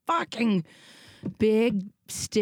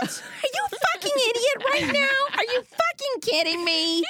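much higher, laughing shout; mock furious shout. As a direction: fake rage you can't keep a straight face through. Role-play angry parent.

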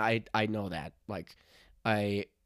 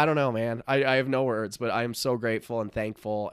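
ordinary delivery

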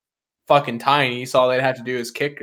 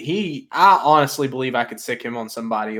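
ordinary delivery

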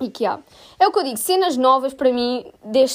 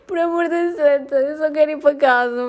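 tearful. Retold or imitated. Crying, begging to go home.

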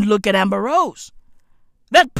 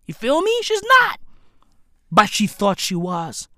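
ordinary talk

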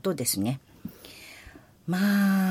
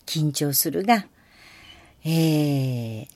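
ordinary talk, nothing marked

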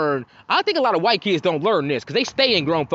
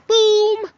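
mock boom; 5 dB louder than usual. Elevator arriving.

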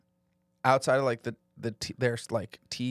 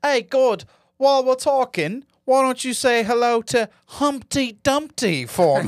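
high, loud sneering comic voice; hammed-up mockery of a British stand-up; mock smug comic; 11 semitones higher, 8 dB louder than usual. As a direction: taunt God like a smug stand-up. Mocking atheist comic addressing God.

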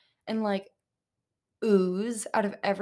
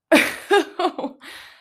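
high loud burst of laughter; incredulous laugh; 8 semitones higher, 8 dB louder than usual. Real, not acted. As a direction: laugh in disbelief. Reacting to quote.

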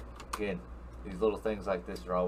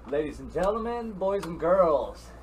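announcer voice; 6 semitones higher than usual. Acted. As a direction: roll it out like a ring announcer. Host opening announcement.

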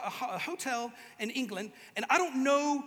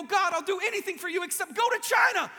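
shouted plea; 6 semitones higher, 7 dB louder than usual. Pretend. Pleading prayer to God.

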